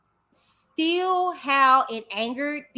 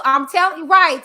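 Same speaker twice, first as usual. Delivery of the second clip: louder, firm hype; loud agreement; 4 semitones higher, 9 dB louder than usual. Real, not acted. Agreeing loudly with a Texas viewer's comment, hyped.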